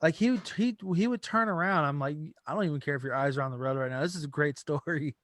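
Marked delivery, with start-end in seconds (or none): 2.4-5.2 lower, fast, quiet urging; eager rushed aside. Retold or imitated. Drunk passenger to driver telling the story, fast low eager urging. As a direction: rattle it off low, hooked on the story.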